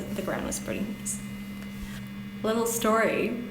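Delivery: ordinary delivery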